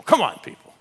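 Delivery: ordinary delivery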